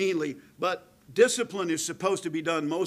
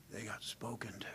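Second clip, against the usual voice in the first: almost a whisper; 6 semitones lower, 13 dB quieter than usual. Shown as a demonstration. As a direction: drop to a hushed, grave near-whisper. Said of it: "Very quiet, almost a whisper".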